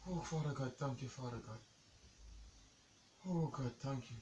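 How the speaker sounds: low, soft thanks to God; prayer of relief. Real, not acted. Shaken partner thanking God, low relieved prayer. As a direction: pray quietly after a scare.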